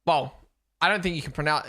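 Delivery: ordinary talk, nothing marked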